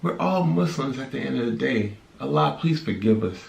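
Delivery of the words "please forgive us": normal talking voice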